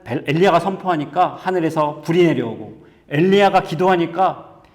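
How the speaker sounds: ordinary delivery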